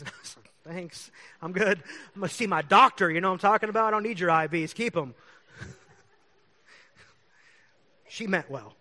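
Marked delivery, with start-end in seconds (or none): 0.8-5.1 mock brush-off. Telling the nurse neighbor he'll see his doctor, loud brush-off. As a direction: wave off the offer loudly and firmly.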